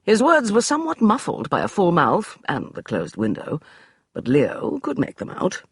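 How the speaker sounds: usual speaking voice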